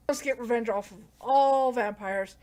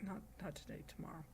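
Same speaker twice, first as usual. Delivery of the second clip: very soft, lower voice; quiet refusal; 4 semitones lower, 20 dB quieter, faster than usual. Retold or imitated. He puts her off till tomorrow, quiet retold refusal. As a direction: drop it right down, barely voiced.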